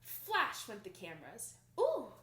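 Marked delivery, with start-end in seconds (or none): 1.8-2.1 high, loud crowd ooh; awed ooh. Acted. Crowd watching Annette's robe drop, awed. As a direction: let out a wowed ooh. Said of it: "breathed the crowds".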